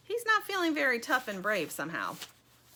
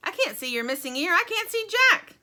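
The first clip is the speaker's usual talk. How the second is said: loud yell climbing much higher; high, loud exasperated character voice; 10 semitones higher, 8 dB louder than usual. A narrator's reading. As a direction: yell it, rising to a high blare. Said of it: "yells the thing in the box".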